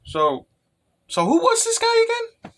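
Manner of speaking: high-pitched puzzled question; confused Kaguya. Retold or imitated. Kaguya just woke up, asking who he was, high and puzzled. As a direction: squeak it out, lost and clueless. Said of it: "She's like".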